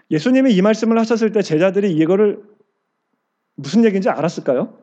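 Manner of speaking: normal talking voice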